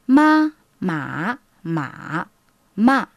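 ma dit avec les quatre tons chinois, haut, montant, descendant-montant, descendant; enchaîner ma sur les quatre tons du mandarin. Shown as a demonstration. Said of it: "Par exemple". (les quatre tons de ma)

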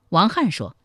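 plain reading voice

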